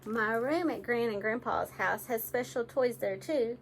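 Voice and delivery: ordinary delivery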